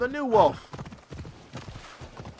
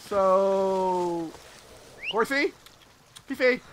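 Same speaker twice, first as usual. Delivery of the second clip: normal talking voice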